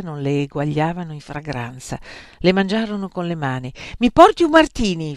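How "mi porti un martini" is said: high, loud demand; raised, imperious request; called-out order. A narrator's reading. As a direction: call for a drink urgently. Arrow calls order to waiter.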